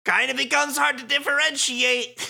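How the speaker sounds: high, loud, exaggerated character voice; hammy villain parody. Two villains with matching voices, hammy. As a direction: keep up the silly swagger.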